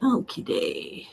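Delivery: slow, low playful drawl; low drawn okie day. Shown as a demonstration. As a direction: stretch it out low and lazy. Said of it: "playful okie day, then repeated".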